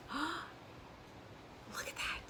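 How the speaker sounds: hushed, breathy gasp of delight; soft astonished whisper over the quilt; awed whisper. Real, not acted. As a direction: whisper it like you can't believe it. Gasping at the finished blocks.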